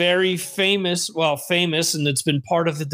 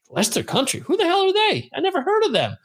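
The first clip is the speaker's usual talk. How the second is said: much higher, mock-puzzled "who the hell"; baffled disbelief; 11 semitones higher, faster than usual. Host retelling his own confusion about the club, high mock disbelief. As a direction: act bewildered by a team you never heard of. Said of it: "confused at unknown team".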